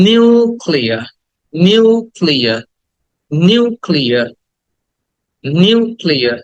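"নিউক্লিয়ার" with a long "niuu", then "klia"; stretched "u". Shown as a demonstration. Teacher repeats for students, "নিউক্লিয়ার" with stretched "ইউ". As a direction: draw out the "ইউ" of "নিউক্লিয়ার", falling at the end. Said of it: "ইউটাকে টেনে বলতে হবে".

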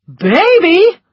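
high, loud outraged cry; thundering. A narrator's reading. Doctor thundering at Scarlett, loud outrage. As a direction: explode in disbelief. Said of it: "thundered the doctor".

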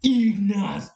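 hamming up the name like a ring announcer; mock announcer call. Imitating how some people call out the name. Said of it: "There's a few people that go".

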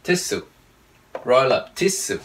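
"tissue" said as "tis-su"; clear S in tissue. Shown as a demonstration. Teacher corrects learners, "tissue" with a clean su ending. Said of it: "Không được bỏ S vào đây ha".

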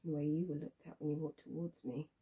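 low, very soft, subdued voice; quiet probing. Acted. Woman probing whether he has a partner, quietly knowing. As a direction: state it softly, as if already knowing.

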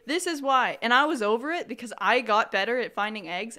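loud, indignant venting; angry rant. Real, not acted. Player beaten by Abigail at finding eggs, angry rant. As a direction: rant loudly about a hated rival. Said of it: "I am so frickin' angry".

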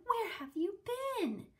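put-on girl greeting her returned pet, high and excited; relieved scold. A narrator's reading. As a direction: ask in happy relief.

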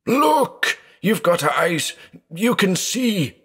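very high, desperate shout, held back in volume; frantic shouting. A narrator's reading. Blind man begging his brother to look, frantic shouting. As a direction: plead at the top of your pitch.

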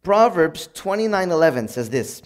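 normal talking voice

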